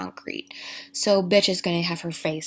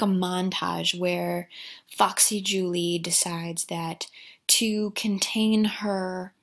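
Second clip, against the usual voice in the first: ordinary talk, nothing marked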